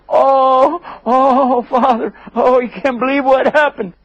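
high, hammed-up mock crying; over-the-top fake lament, pitched high; fake wailing. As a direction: pretend to sob out the bad news. Brothers fake grief to father.

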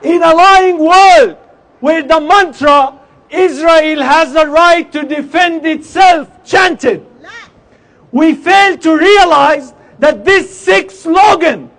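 fiery protest speech; rally the crowd with force; loud soapbox ranting. Acted.

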